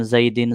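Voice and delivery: زیدن said with an -in ending, "zaidin"; zer ending. Shown as a demonstration. Teacher showing changeable endings, زیدن ending in -in. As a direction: end زیدن on a short i plus n. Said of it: "کبھی زیر".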